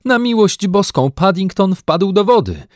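high alarmed shout; calling out. A narrator's reading. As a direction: shout in alarm across the water. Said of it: "wołał Jonathan". (wołanie w panice)

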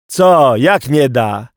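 loud, incredulous challenge; shouting back. Acted. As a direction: throw the question back at full voice. Szyper shouts back to boy.

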